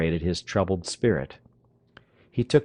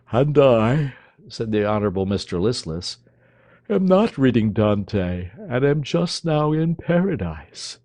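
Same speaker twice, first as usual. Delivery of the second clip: languid dandy; 4 semitones higher, 4 dB louder than usual. Acted. Listless's languid gallantry.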